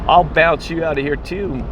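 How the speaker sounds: mock a bouncer's threat without really shouting; retold yelling. Security guard threatens the man stepping in, mildly retold yelling. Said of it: "the security guard yells at me".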